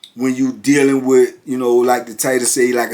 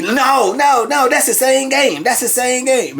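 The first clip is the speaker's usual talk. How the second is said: high, repeated mock protest; indignant denial; 11 semitones higher than usual. Losing chess player rejecting the winner's count, high indignant imitation. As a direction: cut in with a high-pitched objection.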